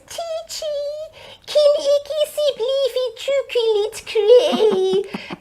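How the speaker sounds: put-on high, slow whiny little boy begging; high pleading child voice; child's plea in Afrikaans. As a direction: beg like a small child for chocolate. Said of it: "that was how English sounded to him".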